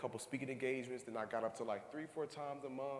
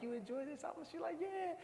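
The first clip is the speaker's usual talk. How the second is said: high coaxing back-and-forth; 5 dB quieter than usual. Retold or imitated. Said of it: "I'm like".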